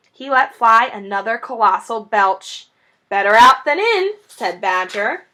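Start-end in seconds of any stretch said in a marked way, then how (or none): 3.1-4.1 louder, higher chuckling quip; jolly joke. Acted. Badger joking about the belch, cheerfully. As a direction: toss it off cheerfully.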